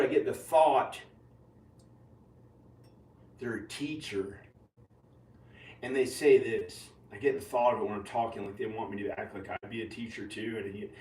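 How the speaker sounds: usual speaking voice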